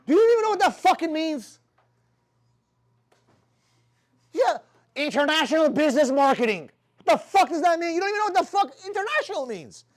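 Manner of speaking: loud, high-pitched scornful questioning; incredulous mocking rant. Mentor ranting at international business students, incredulous mockery.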